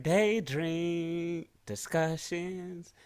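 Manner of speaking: teasing imitation of cohost; slow mocking imitation. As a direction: stretch each word like a parody. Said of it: "you're like".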